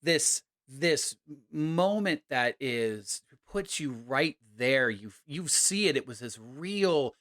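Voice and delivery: dramatic narration. A narrator's reading. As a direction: build the tension.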